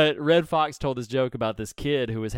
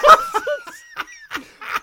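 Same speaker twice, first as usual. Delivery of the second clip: loud, high shrieking laugh; helpless burst of laughter; 8 dB louder than usual. Real, not acted. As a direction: crack up completely. Laughing hard at tease.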